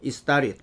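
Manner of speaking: "It started" quickly linked as "istarit"; native-speaker pronunciation. Shown as a demonstration. Teacher repeating the native reading for learners, linked and reduced. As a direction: drop the t's in "It started", flap into "i-starid". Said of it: "한 번 더".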